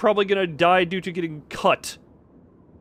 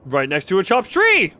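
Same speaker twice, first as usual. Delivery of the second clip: excited; 6 dB louder than usual. Real, not acted. Excited answer to riddle.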